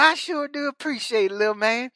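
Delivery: high, hammed-up southern wrestler voice; mock country wrestler talking to a kid; imitating Dixie Dynamite. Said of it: "I said".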